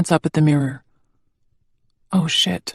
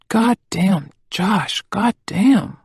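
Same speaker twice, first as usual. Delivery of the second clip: slurring; 3 semitones higher, much slower than usual. A narrator's reading. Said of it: "I'm slurring".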